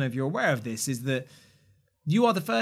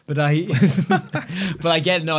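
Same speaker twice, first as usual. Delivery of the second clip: laughing; 3 semitones higher, 7 dB louder, slower than usual. Real, not acted. Laughs at drawing joke.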